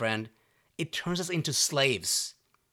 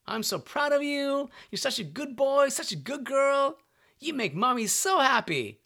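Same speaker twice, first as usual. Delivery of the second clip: put-on higher, sing-song praise to a small child; mom praising her child; 8 semitones higher than usual. Mom praising her little boy or girl, high and gushing. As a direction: gush like a mom proud of her kid.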